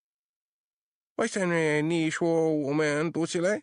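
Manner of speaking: high, slow, put-on Mandarin question; lightly voiced questioning in Mandarin; asking in Mandarin. Laws questioning the old man in Mandarin. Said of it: "Laws asked".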